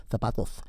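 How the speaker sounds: "zapatos" with a lisped first sound; mock Castilian lisp on "zapatos"; lisped Spain Spanish. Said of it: "must have had a lisp".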